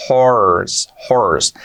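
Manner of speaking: horse drawn out on an English R. Shown as a demonstration. Teacher modelling horse for learners, clear English R. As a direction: linger on the R in horse, falling tune. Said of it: "y la R".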